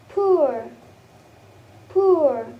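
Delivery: "poor" drawn out with falling pitch; example word demo. Shown as a demonstration. Teacher giving an example word for ur, drawn-out pronunciation demo. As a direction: model "poor", gliding through the vowel. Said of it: "as in the word of".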